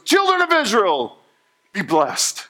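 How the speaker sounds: big, high proclamation to a crowd; Balaam proclaiming. Retold or imitated. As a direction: ring it out like a prophet on a mountaintop. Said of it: "And he goes".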